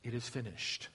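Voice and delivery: soft, near-breathless last words; hushed final cry. Acted. Preacher voicing Jesus' last word, hushed and soft. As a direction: give it up gently, barely voiced. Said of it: "he cried out".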